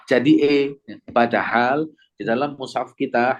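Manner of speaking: usual speaking voice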